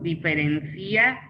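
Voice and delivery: "diferencia" said as di-fe-ren-CÍ-a, rising at the end; stress on the í. Shown as a demonstration. Teacher showing which word takes an accent, "diferencia" stressed on CÍ. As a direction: stretch the "í" in "diferencia", stressed like a verb. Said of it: "pero si decimos".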